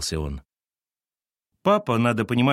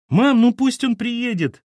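high, loud, slow whining plea; pleading boy; 7 dB louder, slower than usual. Acted. Boy pleading with mum, high whine. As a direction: beg mum, dragging it out.

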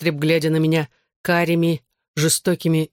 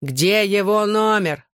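higher, drawn-out angry question; spiteful demand; 5 semitones higher, slower than usual. A narrator's reading. Drunk father searches daughter's phone, spiteful demand. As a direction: stretch it out with understated malice. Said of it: "злобно спросил отец".

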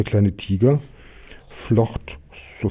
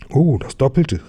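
higher, delighted little exclamation; excited cry; 5 semitones higher, 4 dB louder than usual. Acted. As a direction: call it out, thrilled by the windfall. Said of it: "rief der kleine Tiger".

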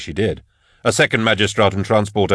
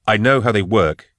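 plain reading voice

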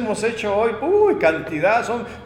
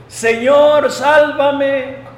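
loud, high plea for rescue; crying out; 6 semitones higher, 6 dB louder, much slower than usual. Acted. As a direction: act out a sinking man shouting for help. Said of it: "dio voces diciendo". (clamor de auxilio, fuerte y agudo)

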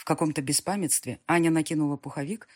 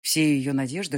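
usual speaking voice

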